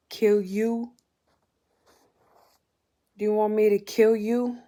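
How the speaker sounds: harsh angry retort. Retold or imitated. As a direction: bark the question back at her. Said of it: "so harshly angry at me".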